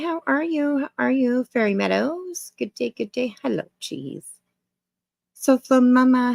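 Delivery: usual speaking voice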